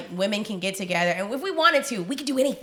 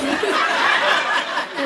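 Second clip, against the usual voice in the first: laugh; 6 semitones higher, 4 dB louder than usual. Real, not acted. Laugh at her own reveal.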